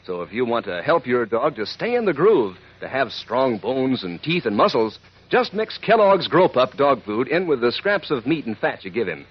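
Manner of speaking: usual speaking voice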